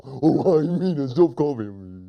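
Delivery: goofy echo of a tantrum; silly hunter. Acted. Silly hunter still copying his upset friend, goofy voice. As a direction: parrot the protest dopily. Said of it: "He repeats everything his friend says".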